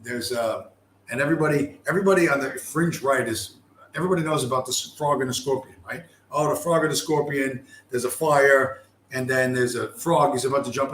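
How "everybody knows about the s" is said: normal talking voice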